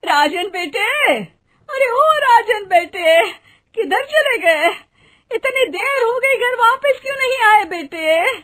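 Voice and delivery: high, loud anxious calling for her son; mother shouting for her missing son, worried. Acted. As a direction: call across the field, fearful.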